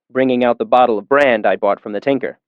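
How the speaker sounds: ordinary talk, nothing marked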